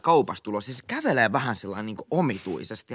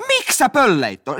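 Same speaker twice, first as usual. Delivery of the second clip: amazed question; 10 semitones higher, 8 dB louder than usual. Retold or imitated. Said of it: "mä katsoin, että".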